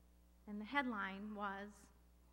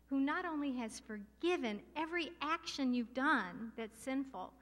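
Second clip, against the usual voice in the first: louder, higher preaching that builds to a peak; full-force preaching; 4 semitones higher, 5 dB louder than usual. Acted. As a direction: drive the praise home, rising in pitch.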